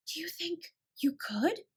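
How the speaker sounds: high, slow, hungry disbelief; startled exclaim. A narrator's reading. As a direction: gasp out the hope. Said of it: "she ejaculated".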